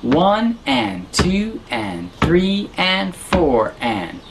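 very slow count of four four time. Shown as a demonstration. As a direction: spell out each beat slowly. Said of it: "we're back in four four time".

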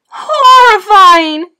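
high, slow, very loud cry; horrified yell. A narrator's reading. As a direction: yell it long and high in horror. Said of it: "yelled his mother and father".

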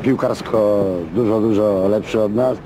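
ordinary talk

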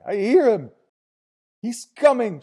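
high, full-voiced ecstatic shout; frenzied shout. A narrator's reading. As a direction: shout it at the top of your voice. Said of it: "shouted Sergeant L".

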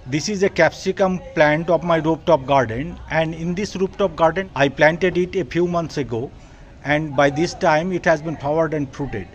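ordinary talk, nothing marked

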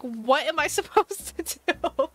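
high, laughing mock panic; helpless mock panic. Retelling her shock at the crazy sprayer, laughing mock panic. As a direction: wail it helplessly through a laugh.

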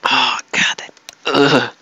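whispered groan of disgust. Real, not acted. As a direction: breathe it out in a whisper.

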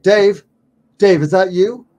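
loud call to a friend in the dark; yelling out. Retold or imitated. Calling to fellow researcher Dave at night, loud yell. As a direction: call out loud across the camp. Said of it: "I yelled out".

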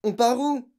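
high, loud startled question; girl exclaiming in dismay; alarmed cry. A narrator's reading. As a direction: cry out, demanding to know. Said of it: "s'écria Mathilda".

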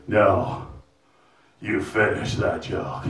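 put-on low slow drunk heckler; deep heckler voice. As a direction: drag it out low like a drunk in sweatpants. Heckler quote acted out.

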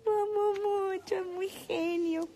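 fake-sobbing, slow and high; mock tearful. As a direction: pretend to cry with affection. Mock-tearful praise of Ale. (llanto fingido y lento)

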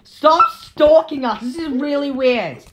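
very loud, high, drawn-out shouting; indignant yell. Pretend. Player tells off the one stalking them, indignant yell.

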